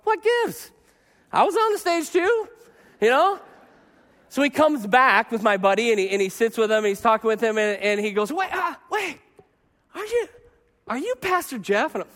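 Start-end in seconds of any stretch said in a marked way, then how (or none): 0.0-3.4 higher, put-out whine of disbelief; mock indignant. Pastor feeling ignored, mock indignant. 8.3-11.9 higher, startled double-take; a fan finally placing the pastor; sudden recognition. Retold or imitated.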